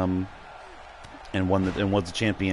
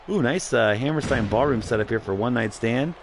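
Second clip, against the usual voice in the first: ordinary talk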